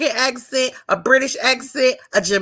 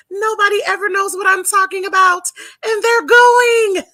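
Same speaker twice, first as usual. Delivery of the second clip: high-pitched exaggerated indignation, drawing out the last words; mock outrage; 9 semitones higher, 4 dB louder than usual. Guest imitates friend upset about missing Kenya, mock outrage. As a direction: wail it like a jealous, put-out friend. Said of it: "she is gonna be so mad".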